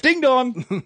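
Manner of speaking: doorbell imitation.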